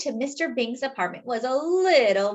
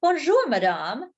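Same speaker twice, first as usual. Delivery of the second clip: put-on French accent; 5 semitones higher, 3 dB louder than usual. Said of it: "which are French".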